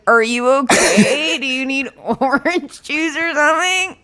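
high, strained attempt not to laugh; suppressed laugh-cry. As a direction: imitate offering help while fighting nervous laughter. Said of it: "force my laugh cry to not happen".

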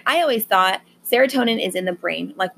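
ordinary talk, nothing marked

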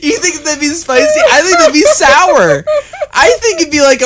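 high and laughing, words squeezed out; amused and giggling while arguing. Real, not acted. Still laughing at laser idea.

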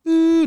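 high, loud short "ooh"; imitated noise, lesser version. Shown as a demonstration. Storyteller showing the noise as done now, high hooted ooh. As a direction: hoot it high, holding back a little. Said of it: "I'm doing that, that".

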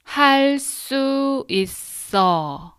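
할 수 있어 drawn out slowly, high and clear. Shown as a demonstration. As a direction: say 할 수 있어 slowly, syllable by syllable. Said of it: "Now slowly three times".

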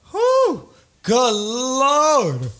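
a high whew, then good lord drawn out; wowed whistle. Real, not acted. Remembering her outfit on the date, he sounds wowed. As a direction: let the memory knock the wind out of you.

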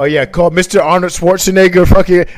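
indignant shout. Real, not acted. Mock outrage at friend's accent.

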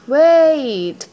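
high, loud call. A narrator's reading. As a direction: call out to stop someone leaving. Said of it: "called the frog".

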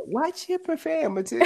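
high, soft imitation of a timid scolding; meek. Quoting a favorite video to co-host, imitated meekness. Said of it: "He says it so meekly".

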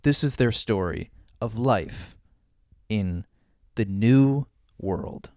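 dramatic voiceover, slow at end; movie trailer narrator. Acted. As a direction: announce the epic tale.

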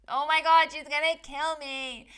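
high, loud, panicked squeal; replaying her own panic at the news; panicked freak-out. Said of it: "I was like".

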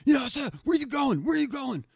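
high, loud mock cop bark; hammed-up high cop voice ordering him to halt; cops shouting at him. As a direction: mimic panicky cops yelling stop. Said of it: "they're like".

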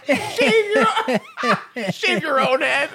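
loud, high laughing words; cracking up. Real, not acted. Co-host cracking up over shaving one's own head, laughing hard. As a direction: break into laughter between the words.

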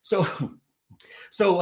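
ordinary delivery

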